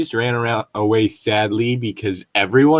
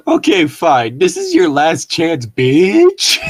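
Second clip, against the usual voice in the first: mock ultimatum; 6 semitones higher, 6 dB louder, slower than usual. Acted ultimatum in the story.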